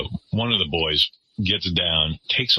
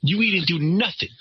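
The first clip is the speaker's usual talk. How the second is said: mock street accent; 11 semitones higher than usual. Retold teen insulting Beth.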